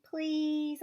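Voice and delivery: high pleading please; begging. A narrator's reading. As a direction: beg him to come. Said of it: "I begged".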